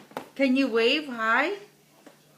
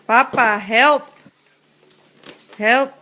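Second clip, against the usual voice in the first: calling out; 8 dB louder than usual. Real, not acted. Calling to papa for help.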